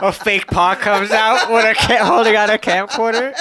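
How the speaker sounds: high, loud words through laughter; laughing. Real, not acted. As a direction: laugh while telling the punchline.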